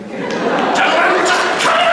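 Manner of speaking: high, loud shout to stop the fight; exasperated shout. Acted. Host yells at squabbling gentlemen, exasperated shout. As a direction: yell at them to knock it off. Breaking up a sketch quarrel.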